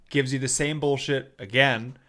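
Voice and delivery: usual speaking voice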